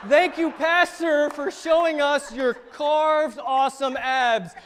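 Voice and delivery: loud, high, slow proclamation of thanks; hammed-up shout of gratitude; mock gushing praise. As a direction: stretch it out like a hammed-up public tribute. Acted grateful crowd praising pastor.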